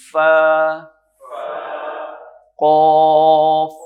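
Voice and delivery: "قاف" held long and loud from the back of the tongue; slow emphatic "قاف"; heavy qaf. Shown as a demonstration. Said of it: "قاف کا جو مخرج ہے".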